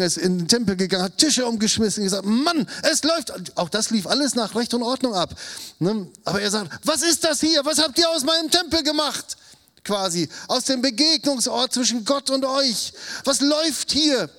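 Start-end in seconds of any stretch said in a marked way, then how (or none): 1.0-3.6 agitated retelling. Acted. Jesus cleansing the temple, agitated. 6.9-9.4 high, loud indignant cry at the temple; angry outburst. Acted. Jesus confronting the temple traders, furious and high. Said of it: "Er regt sich auf". 13.2-14.3 high, loud indignant cry at the temple; angry outburst. Acted. Jesus confronting the temple traders, furious and high. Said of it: "Er regt sich auf".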